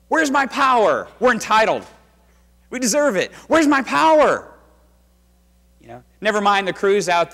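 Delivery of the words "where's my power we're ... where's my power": high, loud, indignant complaining; mock entitled whine. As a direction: whine like a spoiled customer.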